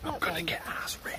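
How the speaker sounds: hushed whisper to the camera. Real, not acted. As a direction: whisper it so the guard won't hear.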